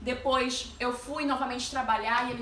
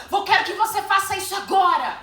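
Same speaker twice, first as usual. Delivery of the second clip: loud, high imitation of a yelling boss; retold angry shout; shouted order; 7 semitones higher, 9 dB louder than usual. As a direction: bark the command, acting out the boss. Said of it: "E gritou comigo".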